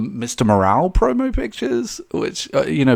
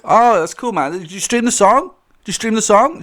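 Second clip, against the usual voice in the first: lightly imitated pop star, pitched up; higher, a hint of needy self-promotion; 9 semitones higher than usual. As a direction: brush off the fan, then push the plug. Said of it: "And [name]'s just like".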